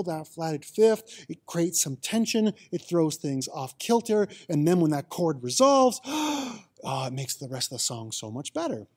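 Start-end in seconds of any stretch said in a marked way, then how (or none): 6.1-7.1 relieved gasp ah. Acted. Chord resolves, relief.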